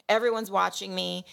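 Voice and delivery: normal talking voice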